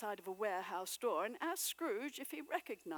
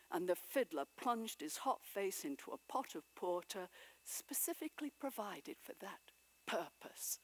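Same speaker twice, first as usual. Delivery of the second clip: plain reading voice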